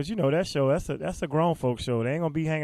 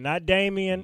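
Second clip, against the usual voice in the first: ordinary delivery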